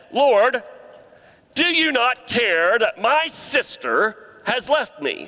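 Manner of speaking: high, loud, accusing cry to Jesus; frustrated Martha. Retold or imitated. Martha interrupting Jesus' teaching, frustrated and high. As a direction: whine it at the Lord, frustrated. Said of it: "And she said".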